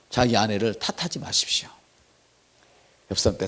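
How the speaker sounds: soft unvoiced whisper; a pastor whispering advice to husbands.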